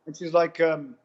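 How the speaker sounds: ordinary talk, nothing marked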